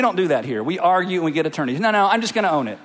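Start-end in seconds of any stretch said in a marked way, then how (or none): none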